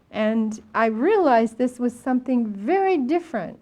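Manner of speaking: ordinary talk, nothing marked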